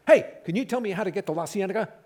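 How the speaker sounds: high, loud request for directions; loud, high ask. Retold or imitated. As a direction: call out the question. The guy asks directions loudly.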